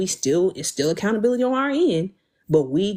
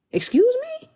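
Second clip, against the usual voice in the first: shocked, very high squeak of disbelief; indignant excuse me; slower than usual. Real, not acted. Host reacting to viewers who say no kids, in shocked high disbelief.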